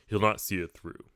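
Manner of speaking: ordinary delivery, nothing marked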